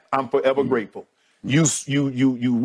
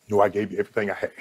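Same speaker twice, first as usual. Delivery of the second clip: low, choked, near-breaking voice; holding back tears; 7 semitones lower, much faster than usual. Real, not acted. Thanking his co-host while fighting back tears, choked. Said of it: "I'm gonna cry in the car".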